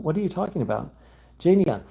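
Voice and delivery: normal talking voice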